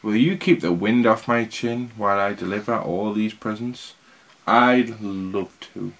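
very slow, drawn-out reading; slow storytelling. Acted. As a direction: savour every word slowly. Santa and beard dialogue.

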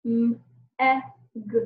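M, e, g sounded one at a time; letter sounds. Shown as a demonstration. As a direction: tap out M ... e ... g with clear gaps. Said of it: "Watch me as I do it again".

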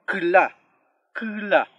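kla, kla loud, the sixth vowel absorbed into the K; vowel after consonant. Shown as a demonstration. Teacher shows learners the vowel taking the consonant's sound, loud careful kla, kla. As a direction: punch out kla, kla with a pause between. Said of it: "tiende a tomar el sonido de la".

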